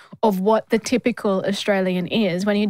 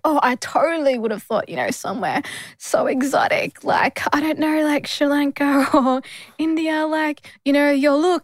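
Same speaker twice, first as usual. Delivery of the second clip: gushing mimicry of clueless curiosity; lightly mocking, higher voice of an admirer; 5 semitones higher than usual. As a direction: imitate a fascinated stranger, mostly pitched up. Retelling stranger guessing her exotic origins.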